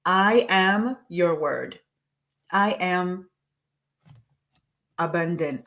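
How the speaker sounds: slow, even dictation pace. Shown as a demonstration. Coach dictating slowly to journaling listeners. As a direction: spell it out slowly so they can write. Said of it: "I'm gonna say it again slowly".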